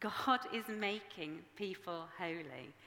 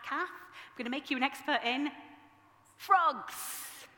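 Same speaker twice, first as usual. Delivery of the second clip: higher and louder, landing hard on frogs; mock threat; 6 semitones higher, 8 dB louder than usual. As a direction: tease her with her worst fear. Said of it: "me saying to Kath".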